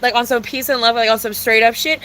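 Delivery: ordinary delivery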